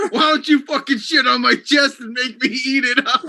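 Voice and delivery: a buddy mimicking a trash-talker mid-argument, mock shouting while laughing. Hammed-up trash-talk yell. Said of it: "he says".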